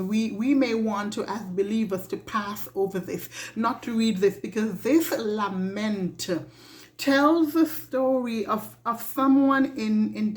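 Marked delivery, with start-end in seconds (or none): none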